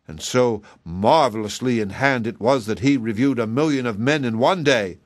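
plain reading voice

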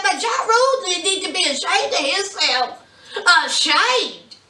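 high-pitched, indignant rant; animated scolding. Real, not acted. Vlogger telling viewers off about filthy festivals, high-pitched scolding. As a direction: scold them in a high, exclaiming voice.

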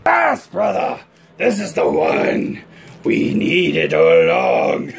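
slow, loud villain gloat. Acted. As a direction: savour the triumph, menacing.

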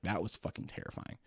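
whispered. Retold or imitated. Recalling childhood fright.

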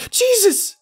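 high panicked shout. Acted. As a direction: shriek it out. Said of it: "Claire shouted".

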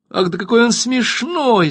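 high, gleeful exclamation; delighted cry. A narrator's reading. Harem wives clapping around Evtukh, crying out in delight. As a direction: squeal with amusement at the guest. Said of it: "закричали остальные".

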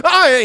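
loud, high cry of waking with a jolt; sudden acted "oh" of alarm; mock startled gasp. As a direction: jolt awake from a dream, hammed-up. Acting out waking from a dream.

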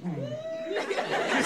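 ordinary delivery

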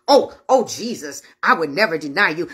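low-pitched, imploring denial; low mock-solemn protest; protesting disciple. Peter's promise to Jesus re-enacted.

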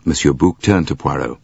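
ordinary delivery

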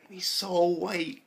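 slow, drawn-out sigh of disbelief; amused disbelief. Real, not acted. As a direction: let it drag out, half laughing at him. Sighing remark on teacher's rapping.

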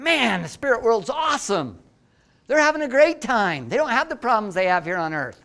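bright carefree excitement, pitched high; carefree enthusiasm. Retold or imitated. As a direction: gush about how great the afterlife is. Cheerful reply to grieving wife.

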